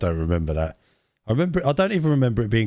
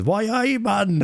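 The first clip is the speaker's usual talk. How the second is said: high Geordie exclamation; 10 semitones higher, much slower than usual. Retold or imitated. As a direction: call it out like the Tango man. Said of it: "And he's".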